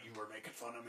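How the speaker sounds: low, hushed, deadly serious; Heisenberg menace. Retold or imitated. Girlfriend confronting him after the door shuts, cold quiet menace. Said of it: "like Heisenberg".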